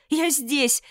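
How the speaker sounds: high, loud call for help; shouting for help. A narrator's reading. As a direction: yell with all your lungs. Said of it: "закричала во всю силу лёгких". (крик о помощи)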